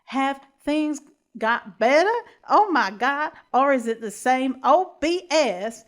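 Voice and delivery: loud, badly faked Valley Girl accent. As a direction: overdo a mock American Valley Girl. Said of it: "turning into the accent again".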